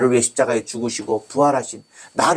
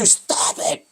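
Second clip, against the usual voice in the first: hissed rebuke. Retold or imitated. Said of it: "갑자기".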